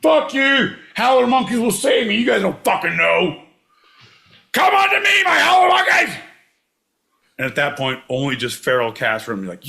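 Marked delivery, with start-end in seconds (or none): 0.0-6.2 very high, loud yelling; shouting call. Pretend. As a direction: bellow out across the jungle. Calling out to howler monkeys.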